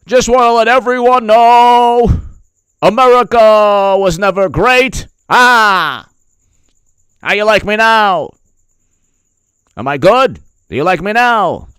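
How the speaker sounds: high, loud mocking politician voice. Mocking a politician giving a speech, high and loud. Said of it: "then he says".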